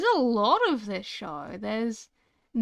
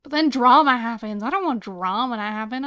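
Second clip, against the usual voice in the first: exasperated; 9 dB louder than usual. Real, not acted. Complaining about dramas.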